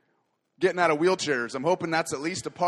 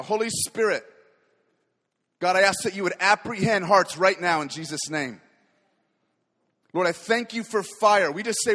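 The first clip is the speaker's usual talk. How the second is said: rising, impassioned prayer that swells louder; fervent prayer; 5 dB louder than usual. Acted. Preacher praying aloud over his church, fervent and building. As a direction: pray over the congregation with growing fire.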